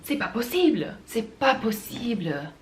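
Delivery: milk it like an exasperated Parisian, drawing out the last one; dramatic exasperation. Shown as a demonstration. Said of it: "it's a very dramatic thing".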